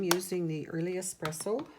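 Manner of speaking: ordinary talk, nothing marked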